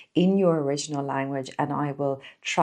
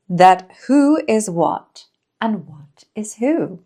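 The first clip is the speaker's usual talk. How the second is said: slow, louder, lilting poem reading; poem recitation; 3 semitones higher, 6 dB louder, slower than usual. Acted. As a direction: read the verse out slowly, savouring each pause. Reads a children's poem aloud.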